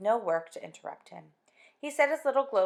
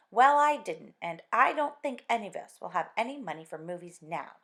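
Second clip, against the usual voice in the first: sharp, loud retort; a little sharply; 7 dB louder than usual. Acted. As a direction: snap back at him about money. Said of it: "a little sharply".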